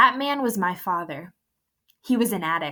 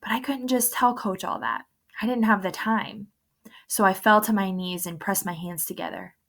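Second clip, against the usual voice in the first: plain reading voice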